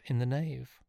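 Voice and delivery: ordinary delivery, nothing marked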